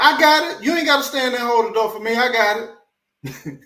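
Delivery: high, loud and fast, brushing off help; mock a proud woman who doesn't need the door held; high, quick, hammed-up independent lady; sassy princess.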